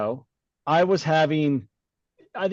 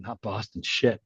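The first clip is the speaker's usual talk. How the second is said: normal talking voice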